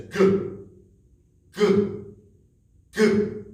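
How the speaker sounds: loud high "Goo" with rounded lips; gorilla goo sound. Shown as a demonstration. Teacher showing kids the gorilla's letter sound loudly. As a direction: belt out "Goo" like a playful gorilla. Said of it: "peels the banana and says".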